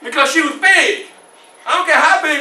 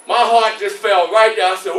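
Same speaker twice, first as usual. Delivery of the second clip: ordinary delivery, nothing marked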